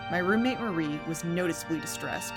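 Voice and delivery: ordinary delivery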